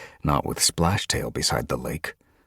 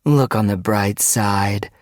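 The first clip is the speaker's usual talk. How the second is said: high, slow, loud taunt; mock cheer; 5 semitones higher, 5 dB louder, slower than usual. A narrator's reading. Guard goading the trapped leader, mock cheer. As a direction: draw it out with false encouragement.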